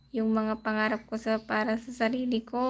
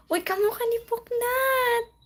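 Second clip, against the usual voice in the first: much higher, delighted squeal; excited squeal; 10 semitones higher than usual. Real, not acted. A streamer squeals at a dog photo from a viewer, excited.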